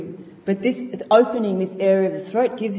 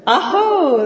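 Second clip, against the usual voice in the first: high, louder exclamation of aho; joyful aho; 11 semitones higher, 5 dB louder than usual. Acted. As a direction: call it out like an awakening.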